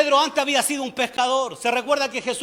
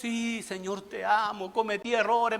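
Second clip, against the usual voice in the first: soft, subdued acted prayer; quiet, penitent answer; humble confession; 3 semitones lower, 8 dB quieter, slower than usual. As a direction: begin the confession in a hushed voice. Acting believer confessing to God.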